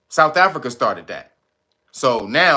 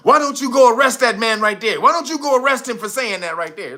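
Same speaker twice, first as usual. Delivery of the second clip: mock Trump demand; 7 semitones higher than usual. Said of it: "Trump was like".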